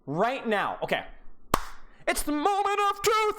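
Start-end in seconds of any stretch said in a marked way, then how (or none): 2.1-3.4 dramatic announce. Real, not acted. Big moment in the replay.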